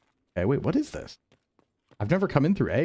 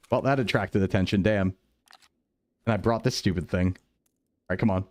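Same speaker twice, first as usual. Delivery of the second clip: ordinary delivery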